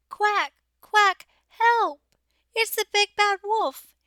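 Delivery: a tiny duckling crying for help; little duckling in a panic; frightened cry. A narrator's reading.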